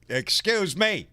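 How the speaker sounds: slow, drawn-out rude call; pushy shopper demanding room, loud and rude; loud rude excuse me. Retold or imitated. Caller mimics rude shopper's loud slow excuse me. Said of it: "real loud".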